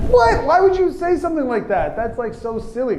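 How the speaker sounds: high-pitched burst of disbelief; incredulous. Acted. Friend hearing the boy's confession, incredulous. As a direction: start loud and high, then settle into dismissing it.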